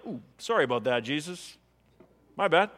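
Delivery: lightly mock-sheepish mumbled apology. Imagined Thomas apologising to Jesus, mock sheepish. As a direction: toss off an awkward little apology.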